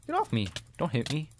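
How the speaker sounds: usual speaking voice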